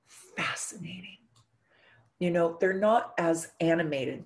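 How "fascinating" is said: slow, breathy hushed whisper. Acted. Astrologer describing the hypnotic Scorpio rising, slow whisper. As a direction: whisper it like a spell.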